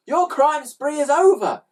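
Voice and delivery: high, loud hero's proclamation; defiant boy. A narrator's reading. Boy hero defies the villains.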